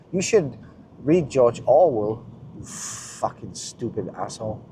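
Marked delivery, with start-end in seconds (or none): 2.6-4.6 much softer muttered curse; muttered insult. Acted. Ranting vlogger mutters an insult at readers, much softer.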